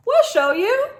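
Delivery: high, loud eager shout; excited goats. A narrator's reading. Goats eager to show the way, excited shout. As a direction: jump in eagerly.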